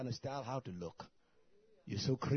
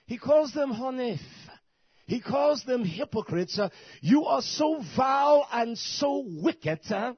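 much higher and much louder, shouting hellfire; full-force preaching; 7 semitones higher, 11 dB louder than usual. Acted. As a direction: preach it with everything you've got.

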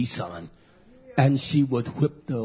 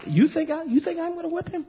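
incredulous retort; 3 dB quieter, faster than usual. Retold or imitated. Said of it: "I said".

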